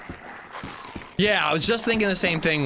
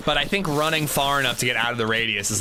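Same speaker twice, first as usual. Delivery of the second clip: ordinary talk, nothing marked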